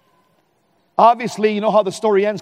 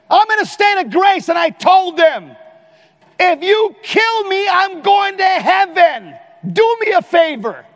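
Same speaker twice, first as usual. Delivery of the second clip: fearless shout; 10 semitones higher, 5 dB louder than usual. Retold or imitated. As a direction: boom it out with defiant faith. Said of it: "You know how come I wasn't afraid?".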